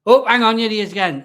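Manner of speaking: sudden high, loud; startled by the cat coming back; surprised. Real, not acted. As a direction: break off, startled. Surprised interruption.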